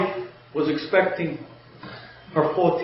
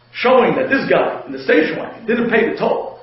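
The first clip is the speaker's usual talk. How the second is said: ordinary talk, nothing marked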